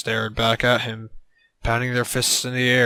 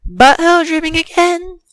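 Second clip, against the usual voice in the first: loud, high monotone question; 11 dB louder than usual. A narrator's reading. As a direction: hold one high, loud note. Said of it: "she asked in a monotone".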